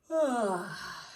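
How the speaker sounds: loud high drawn-out yawn sound; acted yawn. Shown as a demonstration. Teacher acting out a yawn for the word yawned.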